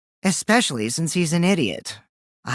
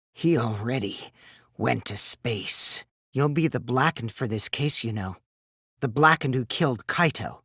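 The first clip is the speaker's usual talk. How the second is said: lower, softer, sinister murmur; taunting a dying rival in a low voice; menacing low taunt; 7 semitones lower, 7 dB quieter than usual. Acted. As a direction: gloat quietly over him. Mastermind taunt to dying Kaito.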